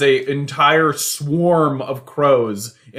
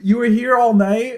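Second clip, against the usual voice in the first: high, louder bird question; lightly voiced bird; 12 semitones higher, 5 dB louder than usual. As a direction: ask it in a squeaky bird voice. Bird voice asks.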